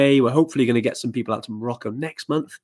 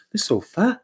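high, lilting imitation Welsh accent; mock Welsh; 9 semitones higher, slower than usual. Host mimicking co-host's Welsh-sounding sofa, mock Welsh. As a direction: tease with a rising Welsh lilt. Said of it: "You sounded really Welsh then".

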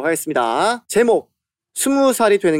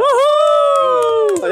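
long high whoop; whoop, high and stretched out; excited whoop; much slower than usual. Real, not acted. Cheering the host's opening, high whoop.

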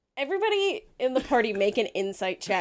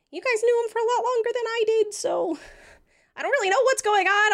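Talos voice; 8 semitones higher than usual. Acted.

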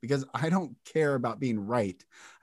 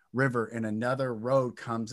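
ordinary talk

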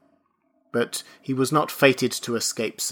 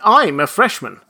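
insistent, higher and louder; eager; 7 semitones higher, 7 dB louder than usual. A narrator's reading. Benjamin insists to the registrar, eager. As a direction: push the claim out eagerly. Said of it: "burst out Benjamin".

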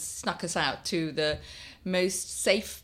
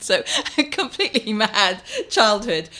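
laughs through it, a bit louder; laughing; 4 semitones higher, 7 dB louder than usual. Real, not acted. Guest laughing as she recalls pets and a monkey.

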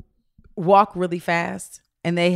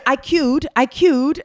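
slightly higher put-on British accent; repeated mock British claim; fake British accent; 5 semitones higher, 3 dB louder, much slower than usual. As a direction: proudly claim you queued, British style. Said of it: "trying to speak like y'all".

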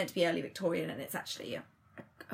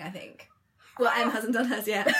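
usual speaking voice

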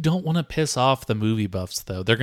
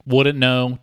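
ordinary delivery

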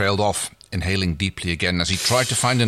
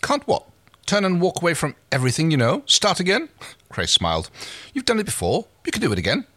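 Crace, pitched higher, proud and persuasive; lightly voiced scheming captain, raised pitch; 11 semitones higher than usual. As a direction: reader lifts her voice for Crace's pitch.

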